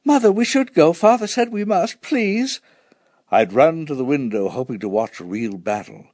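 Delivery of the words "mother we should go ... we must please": put-on high-pitched child's plea; pleading. A narrator's reading. Son begging Mother to take shelter, pleading. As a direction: plead with a parent, high and urgent. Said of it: "however hard I pleaded".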